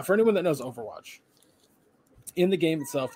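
normal talking voice

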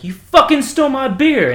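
loud, high accusing shout; high-pitched outraged holler; retold drunken yell. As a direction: yell it like an angry drunk in a story. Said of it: "screaming at him".

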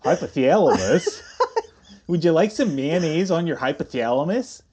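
laughing, hammed-up Minnesota twang; mock Midwestern accent. Co-host offering mayonnaise as a joke, mock Midwestern accent. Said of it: "It's really Midwestern".

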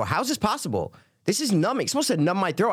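high-pitched retold disbelief; baffled complaint.

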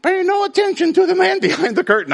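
very high-pitched mock Wizard voice; little fat guy. Preacher quotes the man behind the curtain, high mock voice. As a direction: squeal it like a flustered little man. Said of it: "the little fat guy says".

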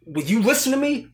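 high, loud retold outburst; mock angry. Acting Ethan pleading with the interrogator, angry outburst. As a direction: burst out as the exasperated captive. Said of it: "gets angry".

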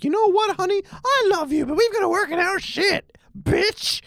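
high sing-song parody of Marge; mock Marge voice. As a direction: squeak it out like a cartoon wife lecturing her husband. Mocking Homer and Marge's usual spat.

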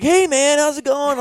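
high-voiced, carefree hello; breezy retold greeting. Intruder greeting the man who chased him off, breezy high imitation. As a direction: imitate a casual visitor, pitched well up.

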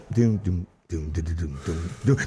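deep beatboxed doom, doom; low beatbox doom. Acted. Beatboxing the beat after his rap verse.

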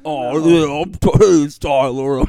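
loud Tyler impression. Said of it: "I have a new voice every episode".

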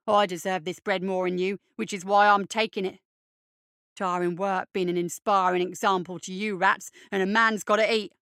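a hint of swaggering tormentor; lightly voiced bully. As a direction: lord it lightly over the younger kids. Bully Eddie's dialogue in narration.

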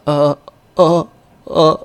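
high stammering anime voice. Retold or imitated. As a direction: stammer it like a flustered anime boy. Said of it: "in the anime voice".

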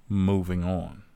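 usual speaking voice

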